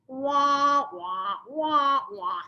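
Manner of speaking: cartoon wah wah trombone voice, loud then fading; Charlie Brown adult voice. Husband's yelling heard as noise, cartoon wah wah fading. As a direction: mimic the muffled teacher sound from the cartoon. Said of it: "his voice sounded like the Charlie Brown voice".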